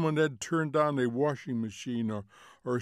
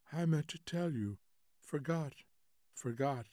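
soft, absent, sleepy fragments; drowsy vagueness; 2 semitones higher, 7 dB quieter than usual. A narrator's reading. Wife admitting she forgot to tell, drowsy and soft. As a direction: trail out faintly, half asleep.

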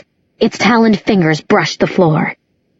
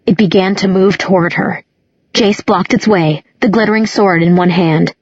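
usual speaking voice

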